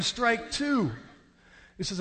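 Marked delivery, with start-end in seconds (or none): 0.0-0.9 high, ringing umpire call; umpire's call. Retold or imitated. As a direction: imitate an umpire calling a strike. Umpire's call in retold game.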